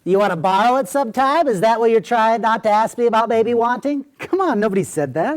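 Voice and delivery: high, insistent comic retort; funny character voice. Acted. Host challenging friend's gossip, in comic character voice. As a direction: push it out high and indignant.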